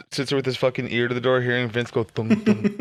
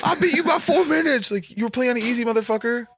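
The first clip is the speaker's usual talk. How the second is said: high, bragging taunt; smug gamer taunt; 8 semitones higher than usual. Retold or imitated. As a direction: gloat over the win. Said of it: "Okay, man".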